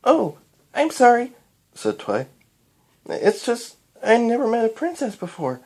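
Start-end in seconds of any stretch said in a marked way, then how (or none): 0.0-1.3 put-on high-pitched child voice, louder; apologetic little girl. A narrator's reading. Excited child apologises to the princess. 3.1-5.6 put-on high little-girl voice; young Twilight. A narrator's reading. Young Twilight explains her excitement.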